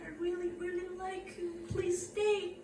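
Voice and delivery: soft, high pleading imitation; pleading softly.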